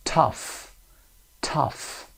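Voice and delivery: "tough" said alone, short UH then F; short UH, final F. Shown as a demonstration. Teacher models OUGH words for learners, short UH and final F. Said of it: "به این شکل صدای آ کوتاه دارن".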